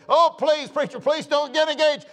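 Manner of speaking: high, loud mock pleading; hammed-up desperate plea; begging dispatcher. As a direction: imitate a frantic dispatcher begging. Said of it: "the dispatcher just begging me".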